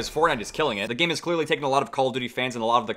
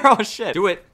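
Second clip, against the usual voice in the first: ordinary talk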